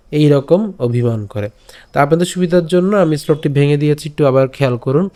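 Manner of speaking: usual speaking voice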